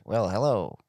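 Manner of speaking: Texan drawl on the greeting; a lightly drawled Texan "hello"; Texas accent. Retold or imitated. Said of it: "mie îmi place ăla din Texas".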